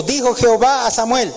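high, slow, intoned Bible reading; solemn scripture reading. Acted. Preacher reading God's words to the congregation, slow and solemn. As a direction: lift each phrase slowly like holy words.

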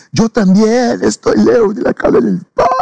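high-pitched wailing sob, mimicking another; imitated crying. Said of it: "él empezó a llorar y a decir".

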